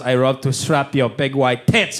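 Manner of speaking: put-on low, slow Japanese accent; playfully abusive Japanese-accented English; Yumi Nagashima. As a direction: imitate your girlfriend, relishing each word in her accent. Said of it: "she goes".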